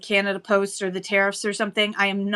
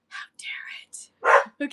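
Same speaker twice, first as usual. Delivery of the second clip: slow whisper pitched higher, playfully indignant; higher mock whisper; 4 semitones higher, much slower than usual. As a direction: whisper it again, lighter and higher, half-joking. Repeat of mock outrage.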